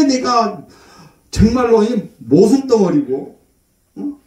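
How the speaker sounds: normal talking voice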